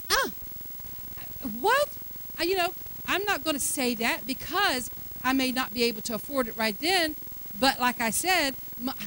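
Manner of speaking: ordinary delivery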